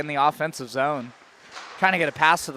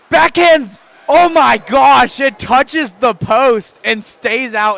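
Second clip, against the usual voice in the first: excited shout; 6 semitones higher, 10 dB louder, much slower than usual. Real, not acted. Near miss off the post.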